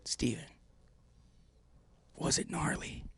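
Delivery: hushed, whispered question to a friend; can't talk loud. Retold or imitated. Injured man pulling Steven in close for a joke, hushed. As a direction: whisper it close, as if too hurt to speak up. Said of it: "I can't talk real loud".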